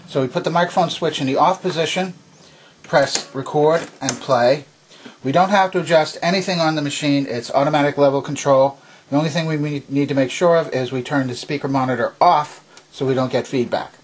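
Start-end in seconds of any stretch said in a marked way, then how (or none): none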